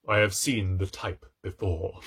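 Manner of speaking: ordinary delivery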